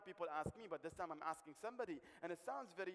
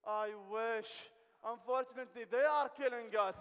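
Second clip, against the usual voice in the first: louder, higher, mock-dramatic; mock loud lament; 4 semitones higher, 11 dB louder, slower than usual. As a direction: deliver the bad news big and slow at the end. [name] admits gorillas kill them.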